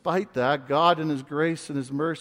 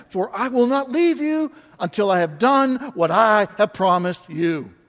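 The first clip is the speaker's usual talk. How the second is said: booming pulpit reading of scripture; scripture proclaimed; 3 semitones higher, 7 dB louder than usual. Acted. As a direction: preach it out with full force. Continues the loud scripture reading.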